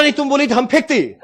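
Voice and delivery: high, insistent South Indian accusation; mock South Indian accent. Offended man accuses the trainee, mock South Indian accent. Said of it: "हम उसका tone का ही मजाक उड़ा रहे हैं".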